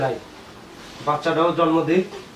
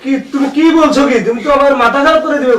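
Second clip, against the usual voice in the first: raised pitch, loud exasperation; indignant protest; 6 semitones higher, 5 dB louder than usual. Acted. Husband denying wife's accusation, loud indignant protest.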